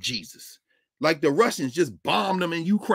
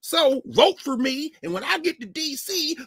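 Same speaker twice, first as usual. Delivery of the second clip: high, drawn-out put-on hillbilly accent; mock hillbilly accent; 8 semitones higher, slower than usual. Candidate wooing hillbilly voters for their vote, mock hillbilly accent. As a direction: play the pandering politician with a stretched hillbilly accent. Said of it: "He's talking like that 'cause you hillbillies".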